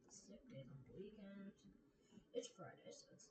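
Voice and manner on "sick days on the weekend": soft unvoiced whisper. Real, not acted. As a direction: breathe the words as a whisper. Whispering video titles to self.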